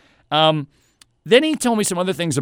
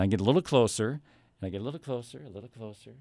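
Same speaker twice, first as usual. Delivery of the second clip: progressively softer, fading almost to nothing; creeping closer; 4 semitones lower, 9 dB quieter, faster than usual. Retold or imitated. Customer edging up to a store clerk, ever quieter. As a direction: sneak up, voice shrinking with each repeat.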